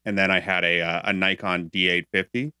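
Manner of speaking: normal talking voice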